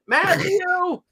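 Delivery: high mock mother calling a name across the house; hammed-up mother calling out. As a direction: yell his name like an old Italian mom. Mother calling her grandson.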